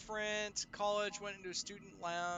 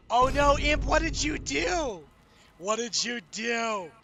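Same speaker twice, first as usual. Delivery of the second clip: mock scolding; 3 semitones higher, 9 dB louder than usual. Playful scolding of raider.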